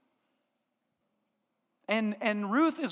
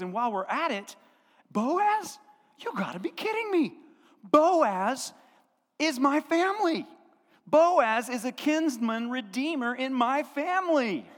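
higher, disbelieving excitement; excited Naomi; 3 semitones higher than usual. Retold or imitated. Naomi tells Ruth that Boaz is family, thrilled and disbelieving. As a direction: squeal the news about Boaz in disbelief.

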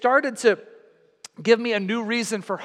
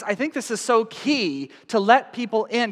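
ordinary talk

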